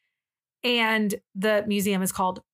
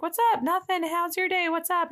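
high-pitched, chatty friend imitation; imitated chirpy greeting; 8 semitones higher than usual. Friend answering a video call, imitated chirpy greeting. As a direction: rattle off small talk up high.

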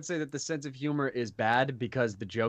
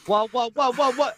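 loud, high rapid-fire protest; urgent alarm; 11 semitones higher, 8 dB louder than usual. Acted. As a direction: cut in loudly to stop them.

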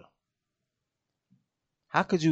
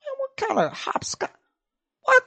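high-pitched disbelief; incredulous squeak. Retold or imitated. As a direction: squeak it out, baffled. Joshua incredulous.

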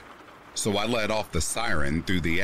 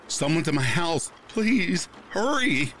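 high urgent plea, pitched way up; panicked yell; 11 semitones higher than usual. Retold or imitated. Caller begging the dispatcher for help, high panicked yell. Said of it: "I yelled into the phone".

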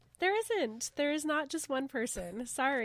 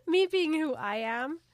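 ordinary delivery, nothing marked

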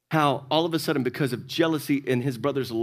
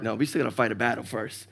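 hushed; 6 semitones lower, 5 dB quieter than usual. Retold or imitated. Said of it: "I'm like".

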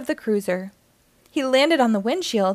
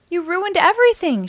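raised, high, hurt accusation; angry outburst; 7 semitones higher, 5 dB louder than usual. Acted. Girl shouting at Stitch in the forest, angry and hurt. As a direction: hurl the blame at him, voice up high. Lilo blames Stitch, devastated.

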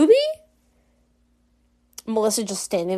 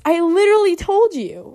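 higher, louder huffy outburst; Ruby; 7 semitones higher, 5 dB louder than usual. Acted. Rejected girl storming off, higher and mock-indignant. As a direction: snap it in exasperation, pitched up. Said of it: "Ruby's just like".

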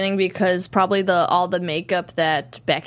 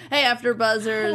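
ordinary delivery

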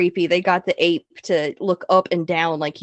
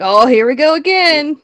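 louder, higher eager cheer; mock excitement; 7 semitones higher, 7 dB louder than usual. Friend teases cohost's eagerness for bath scene, loud high cheer. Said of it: "You got real excited".

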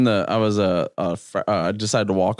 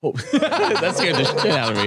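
ordinary delivery

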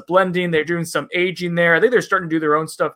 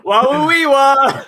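high, loud hammed-up Borat babble; 8 semitones higher, 7 dB louder, slower than usual. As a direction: blurt mock Borat noises while cracking up. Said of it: "Do it in Borat".

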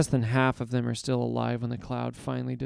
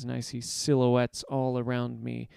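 ordinary delivery, nothing marked

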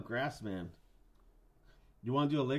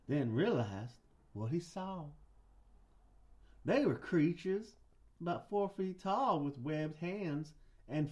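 slow, high-pitched Southern drawl; Southern guy; 4 semitones higher, slower than usual. Pretend. Host narrates Loveland Frogs legend, slow Southern accent. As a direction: stretch it out like a Southern storyteller. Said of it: "I'll do a Southern guy".

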